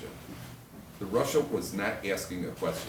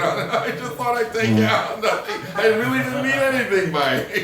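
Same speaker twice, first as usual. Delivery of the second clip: high, loud plea breaking into laughter; hammed-up husband defending himself through laughs; mock protest; 12 semitones higher, 7 dB louder than usual. Husband's flustered protest, acted amid laughter.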